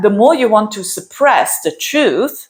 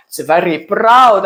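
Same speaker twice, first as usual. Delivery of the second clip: accented, heavily rolled R on "proud"; accidental accent. Real, not acted. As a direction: slip into a put-on accent. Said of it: "I don't know why I have this accent".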